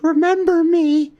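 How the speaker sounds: high, squeaky farewell; dying gingerbread boy. Acted. Dying gingerbread boy to his buddy, plaintive. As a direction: plead in a little cookie voice.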